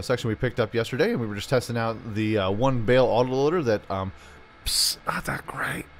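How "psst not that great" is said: hushed near-whisper. Real, not acted. Gamer whispering his verdict on the mod to viewers, hushed whisper. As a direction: drop to a secret whisper. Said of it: "I don't know why I'm whispering".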